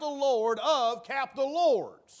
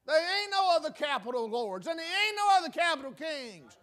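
ordinary delivery

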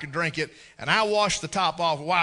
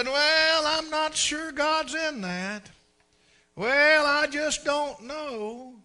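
higher and slow, mock hesitant waffling; doubtful churchgoer; 8 semitones higher, much slower than usual. Preacher mimics a believer doubting God's open door, slow and unsure. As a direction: drag it out like a reluctant believer. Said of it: "we look at it and say".

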